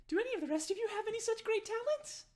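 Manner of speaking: very high, fast question; squeaky Darnsky quizzing the party; squeaky eager host. Acted. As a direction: bubble it out quickly.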